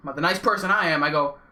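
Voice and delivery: ordinary talk, nothing marked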